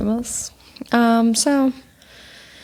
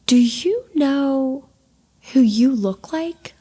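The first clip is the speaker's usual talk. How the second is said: higher, slow, drawn-out sweet lady voice; a light take on the church lady asking, slow and high; sweet Southern lady; 5 semitones higher, slower than usual. Retold or imitated. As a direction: coo the question like a gushing hostess. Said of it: "she goes".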